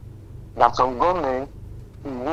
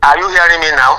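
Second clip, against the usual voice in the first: louder into a weak phone line; speaking louder; 9 dB louder than usual. Real, not acted. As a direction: project so the caller hears. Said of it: "Yes, speak louder".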